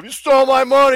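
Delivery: loud, high mock accusation; hammed-up outraged shout; acted heckler outburst. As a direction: yell it as if standing up in a seminar. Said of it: "Bruce would stand up".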